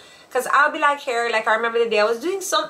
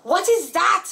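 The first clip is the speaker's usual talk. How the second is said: high-pitched exclaiming kid imitation; mock astonishment; 10 semitones higher, 3 dB louder than usual. Imitating a child who stares at nakedness, mock astonished.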